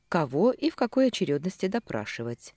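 usual speaking voice

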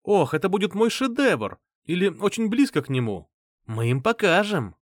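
high, animated proclamation; carried away by a new idea; excited exclamation. A narrator's reading. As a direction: declare it with eager triumph. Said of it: "воскликнул Лин".

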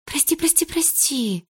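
высоко, быстро, на одном дыхании; тараторь извинения; скороговоркой. A narrator's reading. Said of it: "повторяла она скороговоркой". (торопливое высокое «прости»)

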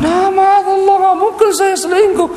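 high, put-on woman's voice protesting; Ummu Ayyub's indignant denial. Wife denying the rumour to her husband, high indignant voice. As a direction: gasp out the denial as a shocked wife. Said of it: "Dia mengatakan".

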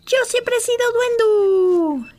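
high, loud, slow goblin yell; triumphant shout. A narrator's reading. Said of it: "gritó".

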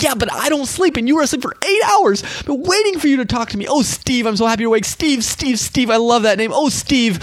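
high, eager, doting excitement; God. Acted. God telling Steve he waited all night, giddy high voice. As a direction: gush in a high voice like someone overjoyed to see a loved one. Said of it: "that God's sitting there and".

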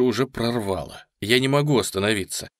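ordinary delivery

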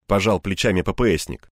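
plain reading voice

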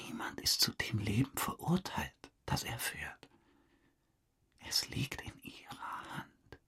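hushed, intimate whisper; confiding whisper. Acted. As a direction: lean in and whisper the offer. Confides to the detective.

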